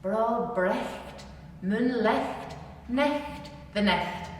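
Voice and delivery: slow, deliberate Ulster Scots saying. Shown as a demonstration. Teacher repeating the Scots saying for learners, slow broad Ulster Scots. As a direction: chew each word in broad Ulster Scots. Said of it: "get your teeth in the right place for that".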